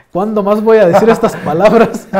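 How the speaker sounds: real laughter breaking through; laughing. Real, not acted. Laughing at own line.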